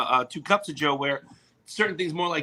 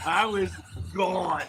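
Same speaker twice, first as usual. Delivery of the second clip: ordinary delivery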